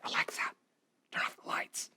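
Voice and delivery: sneaky whispered command. Retold or imitated. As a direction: breathe the words so nobody hears. Prankster next to device whispering.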